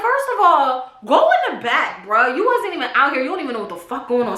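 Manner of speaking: a retold telling-off, half laughing; mock angry yell. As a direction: boss him back to the house. Retold yelling at dad.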